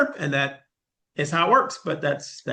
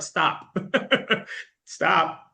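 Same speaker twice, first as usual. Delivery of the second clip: imitating a teacher giggling out "stop"; mock plea broken by a high laugh; 6 semitones higher than usual. Professor laughs at her reaction, giggling stop.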